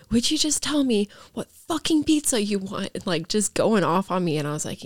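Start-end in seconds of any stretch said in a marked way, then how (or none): 0.1-2.9 higher-pitched imitation of mom yelling; retold screaming. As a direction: replay mom's outburst in a mock yell, pitched up. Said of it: "she just started screaming at me".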